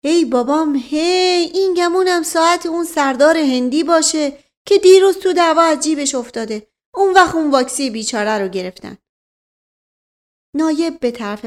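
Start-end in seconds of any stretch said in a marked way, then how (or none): 0.0-9.0 high, loud exclamation of dismay; excited, high-pitched servant's outburst; loud outcry. Acted. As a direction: cry out to the skies in sudden realisation. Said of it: "فریاد مشقاسم به آسمان رفت".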